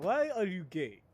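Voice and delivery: imitated Nigerian accent, punchy first word; Nigerian accent impression. Streamer recalling a meme clip, Nigerian accent. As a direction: ask it in a mock Nigerian accent. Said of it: "where he's like".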